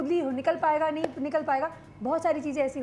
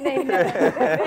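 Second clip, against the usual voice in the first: mock echo; 7 semitones lower, 6 dB louder, much slower than usual. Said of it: "उन्होंने कह दिया never".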